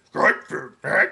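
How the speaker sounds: high, croaky Yoda; Yoda's pinched high voice. Acted. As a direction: squeeze it up into a tiny old alien. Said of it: "I'm gonna attempt that right now".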